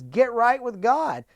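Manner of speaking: a slow, weighty call to the congregation; preaching call. Real, not acted. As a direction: press it home from the pulpit. Preacher's climactic command.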